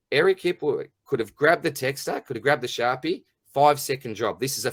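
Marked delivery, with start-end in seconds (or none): none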